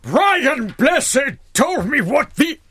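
booming, high, very slow theatrical voice; hammed-up, drawn-out proclamation; Brian Blessed impression. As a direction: bellow each word like a grand old thespian. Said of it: "Can you say it in a Brian Blessed voice?".

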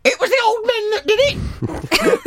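high, slow squawking parrot cry. Pretend. Parrot repeating the thieves.

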